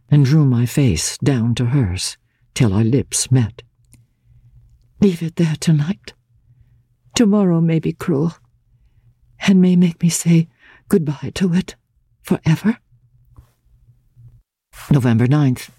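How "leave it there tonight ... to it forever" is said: a lightly voiced young woman, tender and sad; a hint of a gentle girl holding back sorrow, pitched higher. A narrator's reading. Laura's tender farewell.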